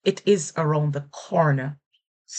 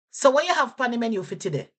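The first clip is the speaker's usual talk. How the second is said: Jamaican patois; 5 semitones higher, much faster than usual. Shown as a demonstration. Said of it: "And I'll repeat that".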